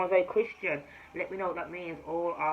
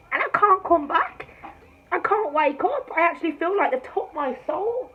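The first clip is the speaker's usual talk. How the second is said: rising panic; 10 semitones higher, 8 dB louder than usual. Retold or imitated. Panicked recalling being taken.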